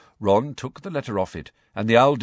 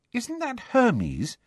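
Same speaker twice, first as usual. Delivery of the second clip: higher and slow, amazed question; drawn out in wonder; slow, high amazement; 13 semitones higher, much slower than usual. A narrator's reading. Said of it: "sounding amazed".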